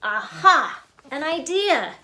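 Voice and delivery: ordinary delivery, nothing marked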